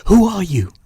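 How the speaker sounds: put-on a bold boy challenging the stranger; boy calling out. Peter demands at the door.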